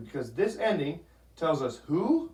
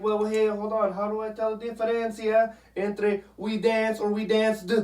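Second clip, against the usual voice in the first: higher, a puzzled student's voice; confused student; 7 semitones higher than usual. Retold or imitated. Teacher imitating a confused student asking about past tense, with a higher puzzled voice. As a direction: play a student who is lightly puzzled. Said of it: "Except you're gonna be like".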